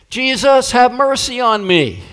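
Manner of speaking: high, loud desperate cry; a blind beggar pleading loudly for mercy. Acted. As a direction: call out for mercy at the top of your voice. Blind man crying out to Jesus.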